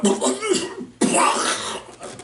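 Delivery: breathless mock gagging noise, high and airy; imitated retching gag. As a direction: fake a choking retch. Friends choking on cyanide dust.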